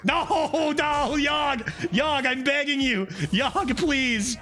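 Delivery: high, desperate pleading to Yogg; frantic begging. Real, not acted. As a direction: beg the card like it's a god.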